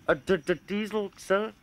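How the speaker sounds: high, shocked stammer on d-, d-; spluttering stammer. Acted. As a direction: choke out the word diesel in dismay. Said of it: "Thomas spluttered".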